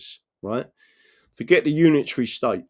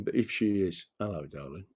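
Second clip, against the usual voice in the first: soft aside greeting; 5 semitones lower, 10 dB quieter than usual. Real, not acted.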